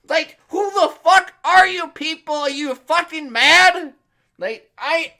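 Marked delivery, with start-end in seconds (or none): none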